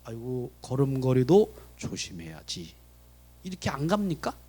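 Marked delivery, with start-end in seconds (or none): 0.1-2.7 slow, low mock-careful mutter; cautela ostentata. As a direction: act suddenly careful because someone is watching. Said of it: "사람 딱 보면".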